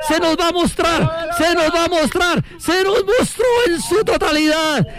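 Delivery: high, exultant commentator's call; triumphant shouting. Real, not acted. Commentator celebrating total eclipse on air, triumphant shout. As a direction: build to a stretched, jubilant cry. Celebrating the moon showing itself.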